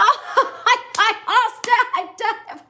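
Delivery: high, laughing mock fluster. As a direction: squeal it out like a flustered fan, giggling. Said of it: "if I'm supposed to be like".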